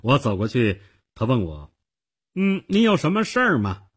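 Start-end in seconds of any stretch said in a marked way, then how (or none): 2.3-3.9 higher-pitched, haughty "what do you want"; arrogant young clerk. A narrator's reading. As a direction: play a cocky ticket clerk, pitched up. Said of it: "一副非常傲慢的样子".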